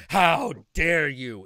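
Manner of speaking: hammed-up offended gasp of a line; mock outrage. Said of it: "it's not in the, like".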